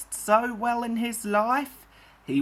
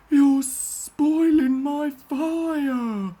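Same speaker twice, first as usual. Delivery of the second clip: high, loud, slow whinge; complaining; 7 semitones higher, 4 dB louder, much slower than usual. A narrator's reading. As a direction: drag out the grumble. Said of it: "complained Henry".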